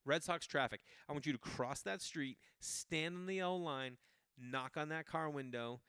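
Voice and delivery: hammed-up mom, slowed down for the key words; mock mom pitch. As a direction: lay out mom's crazy plan with insistence. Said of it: "That was her, this was her pitch".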